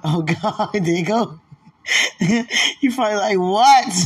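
giggling through the words; laughing. Real, not acted. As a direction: laugh loud and high. Giggly mock reaction, loud.

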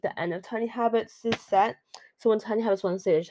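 ordinary talk

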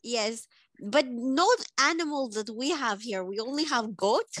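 deadpan put-on Eastern European accent; Svetlana accent. Svetlana greets the podcast hosts in a thick put-on accent. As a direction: play Svetlana, blunt foreign guest.

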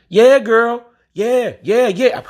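high sassy mock voice. Mock impression of women talking about abortion. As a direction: mimic the women cheering it on.

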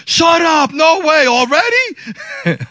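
high, loud imitation of shocked relatives; astonished disbelief. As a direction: hoot it in disbelief. Family astonished by the news.

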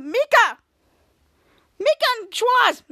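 high, loud call of a name. Retold or imitated. Ray calls Mika through the door, loud and high.

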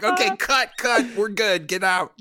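high, loud mock-German director; urgent dismissal in a put-on German accent. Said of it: "You know, with German accents".

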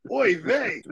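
high, loud mock Yiddish exclamation; Yiddish accent. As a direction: ham up a Yiddish "oy vey".

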